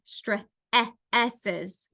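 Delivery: stresses split into stre-, e-, esses; segmented phonics demo of stre-, e-, esses; sounded out. Shown as a demonstration. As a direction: isolate stre-, short e-, then esses with gaps. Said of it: "has the same vowel sound".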